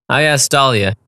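usual speaking voice

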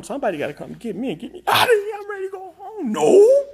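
high, wailing plea to be rescued; whining plea. Pretend. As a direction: whine upward into a loud mock-crying "No". Desperate begging to go home.

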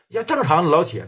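usual speaking voice

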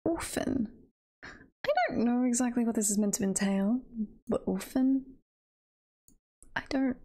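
usual speaking voice

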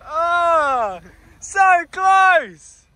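high anguished groan of dismay; dismayed cry. Real, not acted. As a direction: cry out as it almost makes it. Watching car fail the hill.

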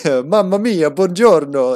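mimicking the game's Italian voices, Italian; mock Italian. Friend retelling the Italian game to viewers, mock Italian accent. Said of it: "they just started going".

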